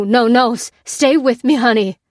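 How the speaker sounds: loud, high pleading, stumbling over words; desperate begging. A narrator's reading. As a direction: plead with someone slipping away. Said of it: "begged the farmer".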